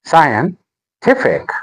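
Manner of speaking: "Scientific" slowly split, scien... TIF-ic; stress on third syllable. Shown as a demonstration. Teacher breaking the word up for learners, "Scientific" as scien, TIF-ic. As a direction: pause before TIF in "Scientific". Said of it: "The stress is on".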